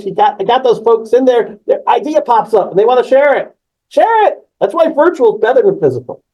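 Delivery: high, excited enthusiasm. Real, not acted. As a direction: get carried away, pitch lifted. Enthusing over people sharing ideas.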